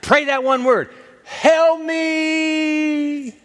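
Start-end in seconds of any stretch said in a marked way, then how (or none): 1.2-3.4 high, long-held plea; a sustained, high cry to God; cried-out prayer. Shown as a demonstration. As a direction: call it out high and drawn out. Models a one-word desperate prayer.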